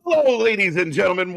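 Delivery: big show-opening hello; announcer voice. Acted. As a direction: greet the crowd like a ring announcer.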